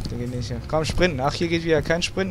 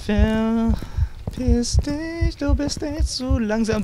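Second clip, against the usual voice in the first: higher, very slow, drawn-out sneer; playful jeering at the chaser; mocking taunt; 8 semitones higher, much slower than usual. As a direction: stretch it out to tease the monster. Taunting the pursuer.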